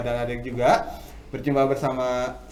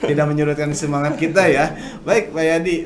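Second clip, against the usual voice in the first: louder, higher hype voice; loud energetic host; 4 semitones higher, 7 dB louder than usual. Real, not acted. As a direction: keep the energy high. Lively live radio banter. (suara penyiar lantang bersemangat)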